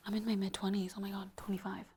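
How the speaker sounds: breathless gasp into a whisper; real shocked whisper at her own age. Real, not acted. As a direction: whisper it like a horrified realization.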